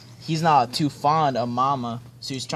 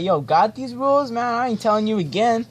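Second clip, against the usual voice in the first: mock mom scolding; 9 semitones higher than usual. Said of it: "she's like".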